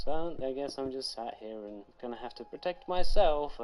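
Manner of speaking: ordinary delivery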